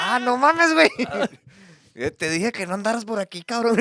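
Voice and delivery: high, loud hammed-up Mexican accent; mock Guerrero Mexican voice. Joking about Mexican guys, high, loud mock Guerrero accent. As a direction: squeal it like a rowdy guy from Guerrero. Mimics Mexican man scolding.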